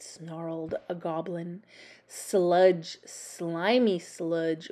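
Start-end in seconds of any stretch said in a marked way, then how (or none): none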